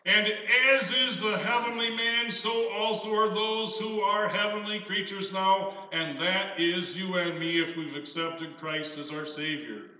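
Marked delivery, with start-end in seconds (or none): 0.0-5.9 higher, lifted pulpit reading; scripture reading. Acted. Preacher reading scripture, rising with wonder. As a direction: lift the verse up with wonder.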